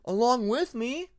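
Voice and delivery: high, slow, incredulous; indignant question. A narrator's reading. Alligator reminding Hyena he was punished too. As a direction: object in disbelief.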